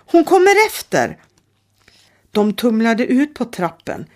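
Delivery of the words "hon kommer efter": loud, very high shout; girl shouting to flee; calling out. A narrator's reading. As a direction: yell Run at your friend. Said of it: "ropade Danja".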